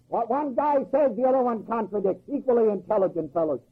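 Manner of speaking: ordinary talk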